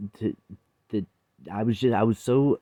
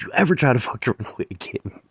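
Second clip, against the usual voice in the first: angry threat; 4 semitones higher, 5 dB louder, faster than usual. Retold or imitated. Mother grabbing son, threatening.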